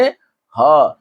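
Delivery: ह as a lone breath-led "ha"; visarga sound. Shown as a demonstration. Teacher answering how visarga is pronounced ह, visarga sound. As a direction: sound ह by itself as a short syllable "ha". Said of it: "इसका उच्चारण कैसे करेंगे".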